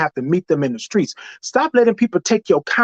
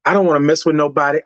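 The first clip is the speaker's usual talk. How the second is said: rushed; 3 semitones lower, faster than usual. Real, not acted. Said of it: "quick rush".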